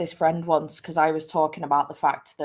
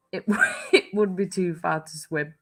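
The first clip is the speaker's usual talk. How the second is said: words broken by a real laugh. Real, not acted. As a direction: giggle through the reply. Laughs while answering chat.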